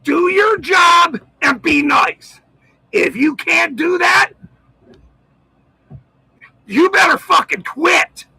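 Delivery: furious shouted callout into the camera; angry rant. Real, not acted. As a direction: rail at them, you've had enough. Angry rant aimed at local cops.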